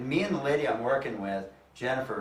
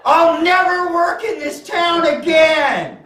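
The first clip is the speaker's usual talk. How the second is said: much higher, much louder laughing shout; yelled; 12 dB louder than usual. Retold or imitated. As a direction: howl it to the sky, laughing. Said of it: "threw my hands to the sky and yelled".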